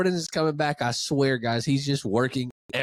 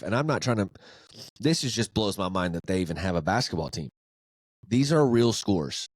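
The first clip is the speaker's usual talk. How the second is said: usual speaking voice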